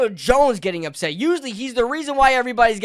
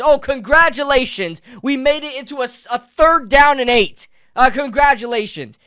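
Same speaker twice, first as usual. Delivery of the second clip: sarcastic congratulations; 5 semitones higher, 7 dB louder than usual. Real, not acted. Sarcastic rant at play calls.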